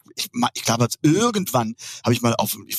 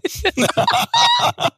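high-pitched burst of laughing; real laughter; 4 dB louder than usual. Real, not acted. Guest laughs at host's hedgehog confession, high real laugh.

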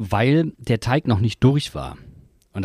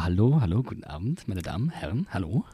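smooth TV host greeting; late-night host voice; 2 semitones lower, 6 dB quieter than usual. Retold or imitated. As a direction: greet the audience like a talk show host. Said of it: "Late-Night-Show-Moderator".

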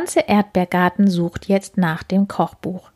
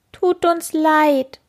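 high, slow sorry whimper; mock whining beetles; 8 semitones higher, slower than usual. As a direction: whine it sadly like small bugs. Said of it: "jammern die Käfer betrübt".

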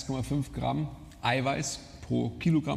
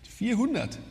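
surprised; 10 semitones higher, slower than usual. Real, not acted. Disbelief at a low calorie guess.

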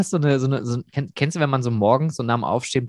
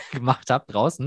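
ordinary talk

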